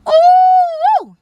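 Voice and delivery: squealed ooh, high and loud; a gossip's thrilled squeal; high excited ooh. Retold or imitated. Bright-skinned lady starts gossip.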